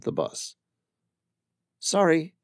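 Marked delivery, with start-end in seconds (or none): 1.8-2.3 higher woman's voice, polite "Sorry." with a falling end; brisk shopkeeper apology; Amira's voice. Acted. Amira to the stranger, politely apologising.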